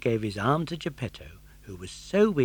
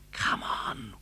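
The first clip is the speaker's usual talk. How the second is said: hushed whisper; 2 semitones lower, 4 dB quieter than usual. Acted. Pinocchio whispering to Geppetto inside the whale. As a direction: breathe it out without voice. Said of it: "whispered Pinocchio".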